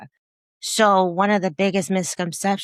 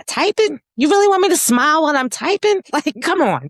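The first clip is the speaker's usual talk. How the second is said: incredulous; 10 semitones higher, 6 dB louder, faster than usual. Retold or imitated. Mocking being told to smile.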